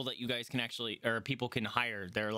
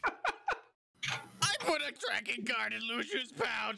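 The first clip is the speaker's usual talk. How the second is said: slow, higher, strained confession after a deep breath; tearful confession; 12 semitones higher, much slower than usual. Acted. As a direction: confess through held-back tears, very slowly. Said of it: "takes a deep breath and says".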